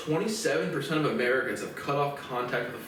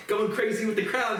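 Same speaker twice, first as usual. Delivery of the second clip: usual speaking voice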